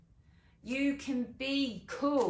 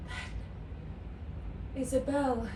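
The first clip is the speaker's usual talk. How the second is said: measured classical delivery with long pauses; resolute Shakespearean monologue; 6 semitones higher, slower than usual. Acted. Isabel choosing chastity over her brother's life, resolute classical delivery. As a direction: start high and settle into grave resolve.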